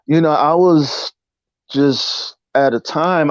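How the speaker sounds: ordinary talk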